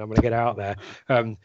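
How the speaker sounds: normal talking voice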